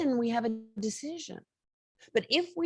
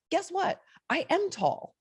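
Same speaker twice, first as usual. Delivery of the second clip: much higher, playful challenge; mock-defiant brag, pitched up high; defiant boast; 10 semitones higher than usual. Real, not acted. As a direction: proudly own it, teasing the listener. Proudly asserting her height.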